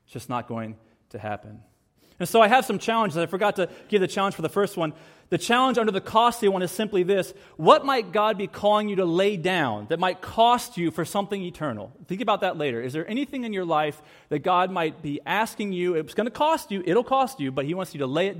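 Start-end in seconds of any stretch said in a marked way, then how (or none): none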